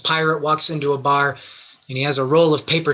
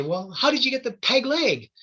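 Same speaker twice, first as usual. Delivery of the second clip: put-on little boy voice; 8 semitones higher than usual.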